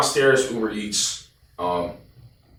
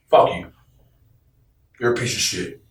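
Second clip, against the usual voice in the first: ordinary talk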